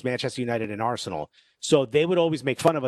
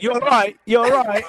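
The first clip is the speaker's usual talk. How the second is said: Mancunian greeting, higher and louder with a laugh; Mancunian accent; 10 semitones higher, 9 dB louder than usual. Retold or imitated. Friend back from Manchester greets them in a Mancunian accent. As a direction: shout a cheery Mancunian hello. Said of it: "a Mancunian accent".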